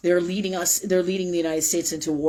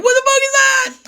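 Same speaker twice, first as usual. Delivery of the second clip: high, loud retold alarm; freaked out; 12 dB louder than usual. Host recalling seeing the demon in the wreath, freaked-out high yelp. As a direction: relive the shock of seeing it. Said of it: "I was like".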